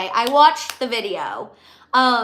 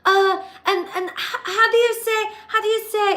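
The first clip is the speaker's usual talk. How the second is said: higher, fake-Spanish-accented fumble; mock Spanish accent; 9 semitones higher than usual. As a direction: mimic a put-on Spanish accent searching for a word. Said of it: "hardcore talking in an accent".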